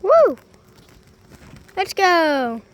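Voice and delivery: loud, high whoop; excited cheer. Real, not acted. Hive closed, cheering.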